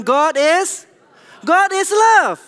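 God is love called out high and loud; loud proclamation. Shown as a demonstration. Preacher leads the congregation in a loud call. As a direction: proclaim it loudly to the whole congregation. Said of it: "say loudly, everyone".

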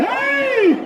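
high arching holler; long high cattle-driving call; high drawn-out "ey!". Real, not acted. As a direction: cry "ey" high and let it fall. Commentator calling out to bulls.

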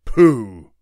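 loud, dismissive bear snort; scornful burst from a bear. A narrator's reading. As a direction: blurt it out loudly in scorn. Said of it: "the bear snorted".

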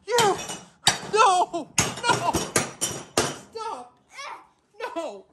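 very high, strained screams of fury; shrieking rage. Acted. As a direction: lose it completely at the kid. Kid smashing plates, dad freaks.

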